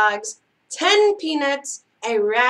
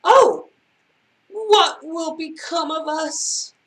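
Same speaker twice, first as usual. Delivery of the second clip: high, theatrical woe; moaning fish; 4 semitones higher than usual. A narrator's reading. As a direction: wail it in a put-on fishy moan. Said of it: "moaned the fish".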